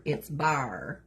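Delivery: "bar" said for "bear", with a drawn-out R; Appalachian "bar" for bear, long R. Shown as a demonstration. Appalachian "bar" for bear, explained to viewers. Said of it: "Instead of, like, bear".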